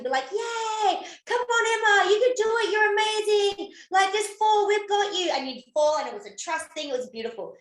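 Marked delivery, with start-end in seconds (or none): 0.3-5.3 high, loud cheering for Emma; high excited encouragement, calling out to a friend; cheering teammates on. Retold or imitated. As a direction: cheer her on from the sidelines. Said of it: "we'd all cheer for the other person".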